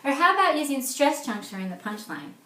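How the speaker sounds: ordinary delivery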